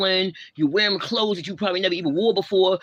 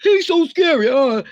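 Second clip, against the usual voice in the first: very high, loud, put-on frightened squeal; mock her with a shrieky pretend fear; hammed-up damsel in distress, pitched way up; mock scared girl; 8 semitones higher, 5 dB louder than usual. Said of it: "What is he?".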